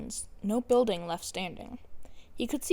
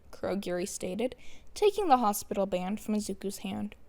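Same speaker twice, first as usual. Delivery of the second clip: ordinary delivery, nothing marked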